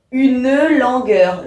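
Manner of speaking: "une langueur" with the e of "une" voiced, u-neu; sounded E. Shown as a demonstration. Teacher demonstrating the sounded E, "une" said u-neu before "langueur". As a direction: give "une" two syllables before "langueur". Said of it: "je dois le prononcer".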